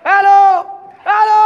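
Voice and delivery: shouted hello into echo. Shown as a demonstration. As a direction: call out loud to test echo. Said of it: "এই পুরো ইকো হচ্ছে".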